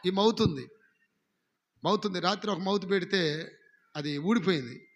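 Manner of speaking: normal talking voice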